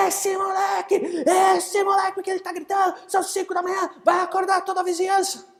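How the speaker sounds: high, loud complaining voice; upset wife. Acted. Wife complaining to her husband about the screaming boy.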